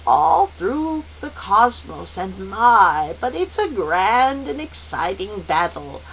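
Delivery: put-on kindly old mentor, slow and singsong; encouraging elder guide. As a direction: inspire the children, lingering on words.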